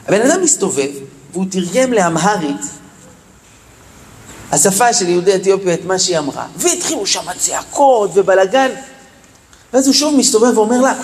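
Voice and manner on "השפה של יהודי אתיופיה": ordinary delivery, nothing marked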